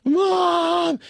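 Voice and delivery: hammed-up teary daughter calling her mother; tearful cry for mom. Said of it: "is just bursts into tears".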